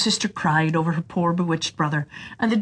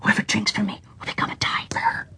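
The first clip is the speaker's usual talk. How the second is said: eerie unvoiced whisper of the spring; spring's whisper. A narrator's reading. Enchanted spring warns the children in a whisper. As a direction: breathe it like a magic voice from the water.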